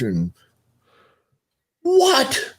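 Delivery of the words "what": startled yelp, much higher; shocked outburst. Retold or imitated. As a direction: react like the news is outrageous. Doctor reacts to chart, storms out.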